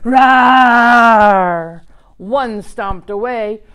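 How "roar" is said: big loud mock roar; dinosaur roar. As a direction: roar like an enormous dinosaur for the kids. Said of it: "let out a roar".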